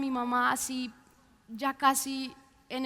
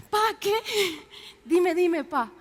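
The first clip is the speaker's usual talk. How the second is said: eager urging; 3 semitones higher than usual. Retold or imitated. Daughter urging her father to finish the vision, eager. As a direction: press him to tell you, eager.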